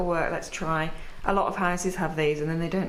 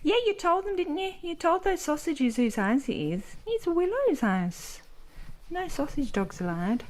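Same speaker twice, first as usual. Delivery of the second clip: high cooing praise; 7 semitones higher than usual. Real, not acted. Voicing praise to the dog.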